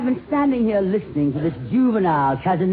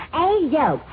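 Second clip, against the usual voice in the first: much higher mock voice of an announcer asking; mock questioner; 9 semitones higher than usual. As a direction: pitch it way up like a fussy questioner. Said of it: "a little funny way of my own".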